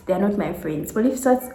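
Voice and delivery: ordinary talk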